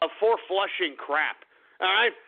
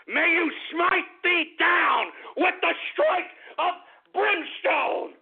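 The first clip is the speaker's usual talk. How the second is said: fire-and-brimstone preaching; 8 semitones higher, slower than usual. Acted. Host preaching doom on the troll, roaring and slow. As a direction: call down the fire from the pulpit. Preaching curse at full force.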